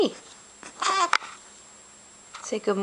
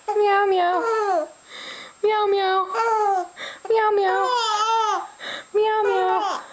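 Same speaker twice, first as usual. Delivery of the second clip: nervous cat voice; 7 semitones higher than usual. Acted. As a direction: meow up high to amuse the baby. Repeated meowing to amuse baby.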